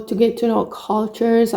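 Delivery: ordinary talk, nothing marked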